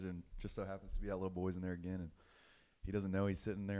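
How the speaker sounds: ordinary talk, nothing marked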